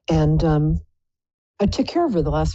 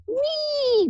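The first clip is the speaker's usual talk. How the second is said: tiny squeaky high wee; high bird squeal of joy. Retold or imitated. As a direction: squeal like a happy parakeet on a fan. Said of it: "close their little eyes and pull their wings in and go".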